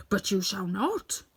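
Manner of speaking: slow, firm hammerhead refusal. A narrator's reading. Hammerhead bars the Scarecrow's way: slow firm refusal. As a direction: lay it down slowly, no arguing. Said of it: "spoke back the voice".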